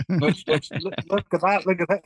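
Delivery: high, eager look at that; excited pointing out. Retold or imitated. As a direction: nudge your mates, all worked up. Bandmates spot their t-shirt on MTV.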